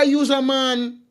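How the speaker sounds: ordinary delivery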